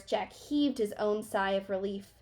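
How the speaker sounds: usual speaking voice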